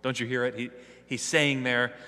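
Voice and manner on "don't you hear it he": ordinary delivery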